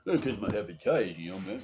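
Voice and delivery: mumbling boxer impression; Rocky Balboa. Sick man telling Jim about hepatitis, boxer impression. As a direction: play a punchy, good-natured movie boxer. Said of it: "start sounding like Rocky Balboa".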